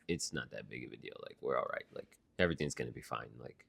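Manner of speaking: low, very soft soothing voice; imitated hushed calming; calm reassurance. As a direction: talk them down gently and quietly. Calming the upset mom.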